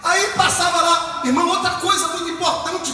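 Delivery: loud, high, shouted preaching; preaching at full force. Acted. Pastor urging believers to stop grumbling and pray, shouted sermon. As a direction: rouse the church, calling them to the altar.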